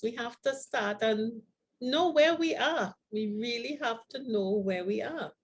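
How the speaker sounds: normal talking voice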